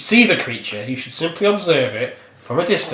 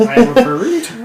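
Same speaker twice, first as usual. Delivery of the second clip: high, louder silly creature voice; burrito creature voice; 9 semitones higher, 4 dB louder than usual. Pretend. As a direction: squeak it like a talking burrito.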